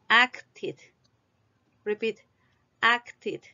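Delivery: "Acted" as two syllables, ending like "tid"; -ed as extra syllable. Shown as a demonstration. Teacher models for repetition, -ed as a syllable. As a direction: model "Acted" clearly, the e said as a short i. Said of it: "se pronuncia como I".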